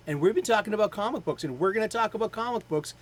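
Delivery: high, loud, fast promo read; brisk, high-energy show outro; announcer sign-off. Real, not acted.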